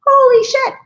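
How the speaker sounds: very high, loud disbelief; sky-high astonished outburst; stunned shock. Retold or imitated. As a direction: gasp it out in total shock. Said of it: "I'm like".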